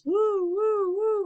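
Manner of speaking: very high siren wail; storyteller voicing passing sirens; siren imitation. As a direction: wail like a fire truck siren. Imitating fire truck sirens.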